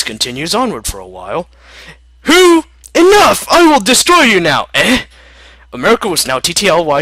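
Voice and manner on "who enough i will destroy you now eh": much higher, louder angry outburst; furious shout. Acted. Canada losing patience with America, furious shout. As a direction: explode with rage.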